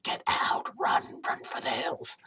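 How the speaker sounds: unvoiced urgent whisper; an inner voice whispering run; urgent gut whisper. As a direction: hiss the warning under your breath. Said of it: "your gut will be screaming".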